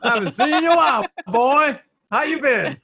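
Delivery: high, loud, excitable hello; squealed joke greeting with laughter; mock reunion greeting. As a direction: play an overjoyed long-lost pal. Echoes the joking greeting, laughing.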